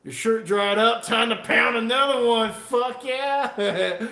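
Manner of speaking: loud hype. Real, not acted. Beer-drinking livestreamer rallying himself to pound another, loud and pumped. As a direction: hype yourself up to chug.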